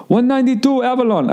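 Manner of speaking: high, mock driver voice; driver's voice. Car service driver insisting on phone, high mock voice. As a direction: squeak it like the clueless driver.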